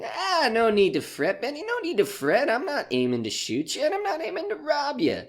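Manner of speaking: high, lilting false soothing; menacing mock reassurance. Gunman mock-soothing the comic, high and lilting. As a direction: soothe him like a threat.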